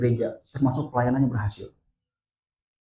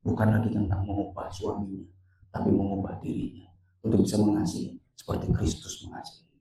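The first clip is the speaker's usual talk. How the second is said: ordinary delivery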